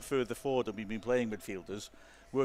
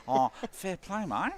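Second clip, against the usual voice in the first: higher, slow, broad Australian "fair play, mate"; hammed-up Australian accent; 7 semitones higher, 4 dB louder, slower than usual. Shown as a demonstration. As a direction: ham up the Aussie accent on purpose. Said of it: "that's Australian".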